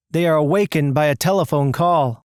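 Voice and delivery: plain reading voice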